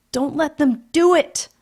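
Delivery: high, loud, desperate wail; wailing child. A narrator's reading. Peter wails to the house, desperate and loud. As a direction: cry out to the house for help. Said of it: "wailed Peter at the ceiling".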